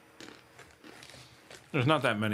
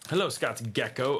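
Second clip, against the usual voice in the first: ordinary talk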